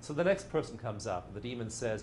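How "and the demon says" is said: ordinary talk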